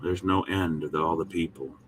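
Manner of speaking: ordinary talk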